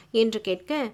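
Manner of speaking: ordinary delivery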